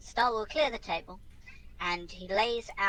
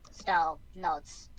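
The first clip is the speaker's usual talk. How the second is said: usual speaking voice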